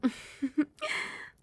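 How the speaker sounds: a pleased, knowing laugh to start; teasing laugh. Acted. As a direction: laugh at him like you own him. Mistress greets her pet.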